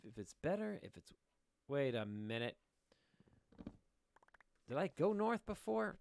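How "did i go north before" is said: surprised realization. Real, not acted. Realizes he has been here.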